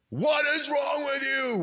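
high, slow shout of disbelief; hammed-up fan yelling at the coach; mock yell at the coach. Fan yelling from the stands at the coach, high mock shout. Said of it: "you're yelling at the, at the coach".

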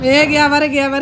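high loud call across the yard; dramatic loud aunty. Real, not acted. Calling out as gate gives way.

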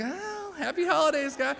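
high, embarrassed little greeting; sheepish greeting. Acted. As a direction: cover your embarrassment with a feeble high hello. Embarrassed greeting to watching coworkers.

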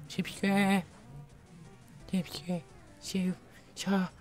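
high, silly hypnotic chant. Pretend. Hypnotic wheel pleading with viewers, high silly chant. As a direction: draw it out in a high put-on voice. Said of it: "hypnotic wheel".

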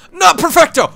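high, loud cry of dismay; shrieking frustration. Real, not acted. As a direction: yelp "Not perfecto!" in shock. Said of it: "angry correction".

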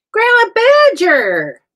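loud, high, drawn-out greeting; delighted shout. Real, not acted. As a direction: holler the name in joyful welcome. Greets a viewer who just joined.

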